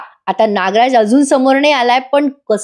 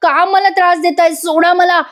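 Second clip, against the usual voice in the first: high, desperate cries to let her go; young woman screaming to her uncle, pitched high; pleading yell; 6 semitones higher than usual. Retold or imitated. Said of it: "आरडाओरडा करत असते".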